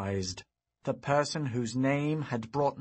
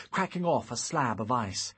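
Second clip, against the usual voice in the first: usual speaking voice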